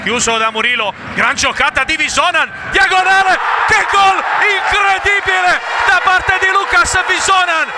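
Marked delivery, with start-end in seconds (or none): 2.7-7.7 goal scream. Real, not acted. Goal call in futsal commentary.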